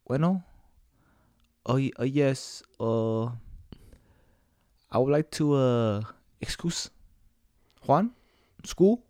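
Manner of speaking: lightly hammed-up Mexican dad excusing his son; fake Mexican dad on the phone. A kid on the phone faking his Mexican dad's voice, hammed up. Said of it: "sound like a guy".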